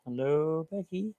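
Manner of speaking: high, soft sing-song greeting; sing-song hello. Real, not acted. Greeting a friend in chat, high soft sing-song. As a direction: lilt up softly on the name.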